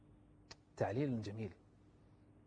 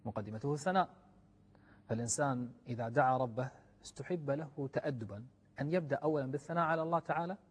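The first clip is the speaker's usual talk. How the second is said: ordinary talk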